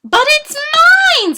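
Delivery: very high, loud, slow scream. Acted. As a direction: shriek it as a furious claim. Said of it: "screamed the bird".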